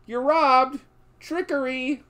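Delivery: high, loud gloating shout; spring the trap with a crow of triumph; triumphant bandit. Retold or imitated. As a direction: outlaw crowing at the tricked driver. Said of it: "whips out his ordnance and says".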